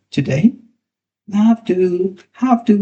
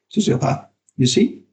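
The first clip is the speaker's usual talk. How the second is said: plain reading voice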